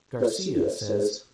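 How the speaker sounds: ordinary delivery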